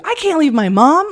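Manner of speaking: loud, high hammed-up kid outburst; mock childish protest. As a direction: exclaim like a child who gave up running away. Mocks herself as runaway child.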